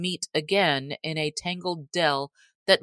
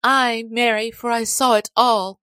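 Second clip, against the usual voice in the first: higher-pitched voice for a lass; lass crying out; 6 semitones higher, 4 dB louder than usual. A narrator's reading. Lass answering Robin about the capture, higher-pitched cry. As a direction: narrator lifts the pitch for an upset girl. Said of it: "cried she".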